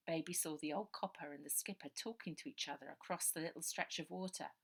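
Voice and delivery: usual speaking voice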